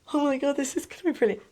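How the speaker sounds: high, fast burst of delight; thrilled. Retold or imitated. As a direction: gush like you just saw it in the mirror. Putting on the wig.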